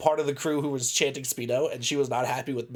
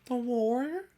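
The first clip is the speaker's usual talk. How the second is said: much higher, slow mock wail; mocking the Warrior call; 10 semitones higher, slower than usual. Friend teasing about the DM, high mock wail. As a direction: tease him with her ringside cry. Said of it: "I was like".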